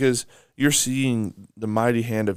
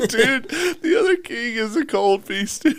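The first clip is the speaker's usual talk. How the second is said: high-pitched laughing through the words; giggling while he says it; 13 semitones higher, 5 dB louder than usual. Real, not acted. Reacting to the rival king's taunt, laughing high.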